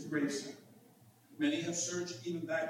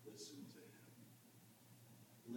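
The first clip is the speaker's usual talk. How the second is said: slow, hushed whisper; whispered command; 25 dB quieter, much slower than usual. Acted. Preacher gives heaven's postscript to the disciples, whispered. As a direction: whisper it slowly, as a solemn postscript.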